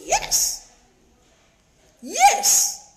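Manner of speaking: high, insistent yes; emphatic yes. Acted. Emphatic confirmation.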